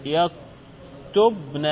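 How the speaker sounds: يكتبن split slowly as yak-tub-na, single n; still ba. Shown as a demonstration. Teacher showing the feminine plural nun, يكتبن as yaktub-na. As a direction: say يكتبن slowly, b closed before -na. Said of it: "هذا هنا ساكن".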